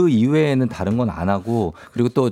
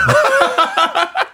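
laughing. Real, not acted. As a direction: burst of high laughter. Big laugh at question.

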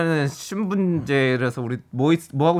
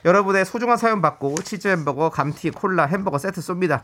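normal talking voice

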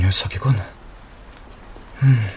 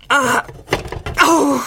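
shrill burn cries, much higher; high pained yelps; much slower than usual. Real, not acted. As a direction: squeal from the heat. Grabs hot pot again.

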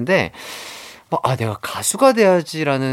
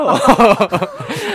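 bursting laugh; 6 semitones higher, 4 dB louder than usual. Real, not acted. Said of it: "이렇게 하는 거 아니에요?".

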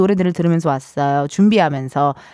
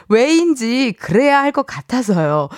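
ordinary talk, nothing marked

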